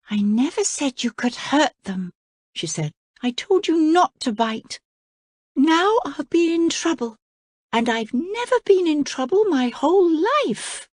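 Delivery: put-on a girl afraid of getting in trouble; princess Cora. Cora frets at the crocodile in a high voice.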